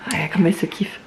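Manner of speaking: soft unvoiced whisper. Real, not acted. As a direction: whisper it so as not to disturb. Aparté chuchoté près du bébé. (chuchoté tout bas)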